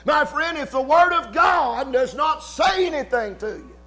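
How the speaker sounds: loud, high fervent preaching; preaching at full force. Real, not acted. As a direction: drive it home to the congregation. Preaching climax, shouted.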